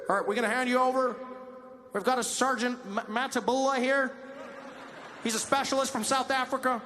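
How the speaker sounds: loud, high hostage negotiator voice; cop negotiator. Acted. Negotiator shouting up to the hiding suspect. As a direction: play the cop calling to the standoff. Police negotiator bit on stage.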